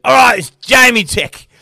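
high, loud, delighted naming; mock-excited director announcing the name. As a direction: blurt it out with sudden glee. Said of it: "He goes".

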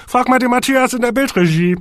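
high, blaring shout; trumpeting. A narrator's reading. Horsti referring them to the video control room, trumpeting. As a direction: blare it out at full voice. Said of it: "trompetete Horsti".

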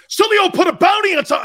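fast, high, hammed-up yelling; loud mocking alarm; mock outrage. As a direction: shriek it like a hysterical accuser. Mocking everyone's outraged reaction.

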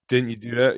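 "didn't" reduced to "dint"; Philly "didn't". Shown as a demonstration. Guest echoes host's example, Philly pronunciation of didn't. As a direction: run didn't together, Philly style. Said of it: "didn't is one".

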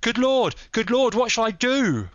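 very high, loud wail of despair; despairing cry. A narrator's reading. Stranger on learning the lady is taken, despairing. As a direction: cry out, frantic and helpless. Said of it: "in an ecstasy of despair".

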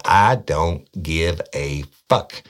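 slow, loud, deliberate brush-off; tough cop retort. Retold or imitated. Cop telling suspect he doesn't care, slow hard loud retort.

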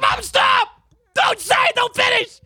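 high, loud frantic begging; panicked kid yelling. Retold or imitated. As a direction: scream like a terrified child. Terrified kid begging mom.

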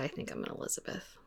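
a hushed, sheepish confession; soft unvoiced confession; whispered admission. Real, not acted. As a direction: whisper it, almost to yourself. Confessing her answer.

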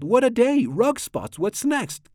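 high storybook exclamations of dismay; high-pitched narrated yell, kept in check. A narrator's reading. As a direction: cry out in exasperation, pitch leaping up. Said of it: "I yelled".

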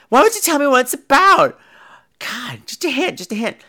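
high, mocking girlish whine; whiny girl imitation. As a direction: make fun of a spoiled birthday girl.

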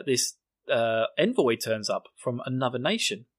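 ordinary talk, nothing marked

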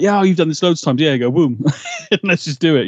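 breezy, hurry-it-along imitation; eager urging. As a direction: lightly act out someone raring to go. Voicing impatient Ava urging Sam on.

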